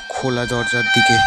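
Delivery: ordinary delivery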